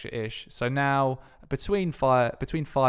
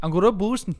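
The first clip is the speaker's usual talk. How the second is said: co-host's higher, louder mock Welsh accent; 7 semitones higher, 6 dB louder, faster than usual. Co-host showing the Welsh accent after the failed try. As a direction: show him how Welsh goes. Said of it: "There you go".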